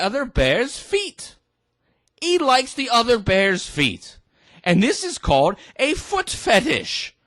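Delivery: high, singsong presenter talking to a teddy; mock children's show host. Host explaining to Teddy, mock-cheery children's presenter.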